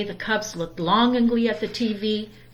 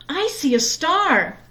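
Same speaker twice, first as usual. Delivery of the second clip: higher, slowed cry of wonder; excited cry; 7 semitones higher than usual. A narrator's reading. Sister spotting the first star, high and thrilled. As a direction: announce the first star with delight. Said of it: "cried Sister".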